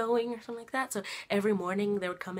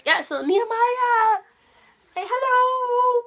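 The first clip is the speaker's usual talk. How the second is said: high, loud, slow coaxing singsong; 10 dB louder, much slower than usual. Real, not acted. Talks to baby son.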